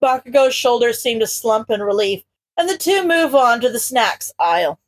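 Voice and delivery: ordinary delivery, nothing marked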